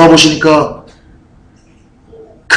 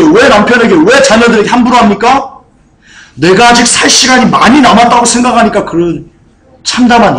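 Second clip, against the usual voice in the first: higher, louder pulpit exhortation; full-force preaching; 7 semitones higher, 4 dB louder than usual. Real, not acted. Pastor confronting listeners who mistreat their families, impassioned preaching. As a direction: challenge the congregation with insistent questions.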